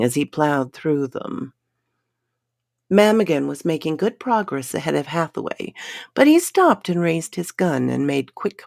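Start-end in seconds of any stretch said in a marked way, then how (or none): none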